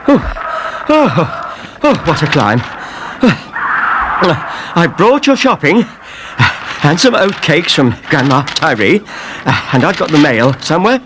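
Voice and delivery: lightly out of breath; puffing from the stairs. A narrator's reading. Eddie after climbing the stairs, a touch out of breath. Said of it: "came puffing up the stairs".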